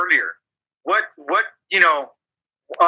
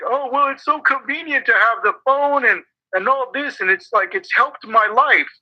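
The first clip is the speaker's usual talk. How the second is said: higher-pitched mock of people making excuses; mocking complainers; 5 semitones higher than usual. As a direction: parrot their silly excuse in a raised voice. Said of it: "for saying stupid shit".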